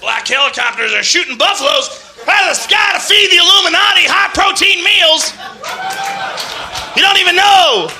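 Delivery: high, loud shouting conspiracy rant; Alex Jones rant. Retold or imitated. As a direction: rant like a paranoid radio host, laughing through it. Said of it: "Alex Jones is a conspiracy theorist".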